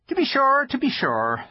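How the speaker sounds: high, timid fawn voice; nervous polite faun; shy fawn voice. Acted. Fawn character voice.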